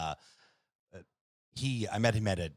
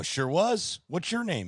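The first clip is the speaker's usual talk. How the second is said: cheery overconfident reply; 12 semitones higher, 9 dB louder, slower than usual. Retold or imitated. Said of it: "I go".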